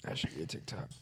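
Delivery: soft whispered echo. Real, not acted. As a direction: whisper it to yourself. Echoes own remark, whispered.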